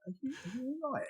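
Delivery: high, hushed tentative check; gingerly concerned. Retold or imitated. Wife checking on her crying husband, gingerly. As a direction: imitate a careful, soft little enquiry.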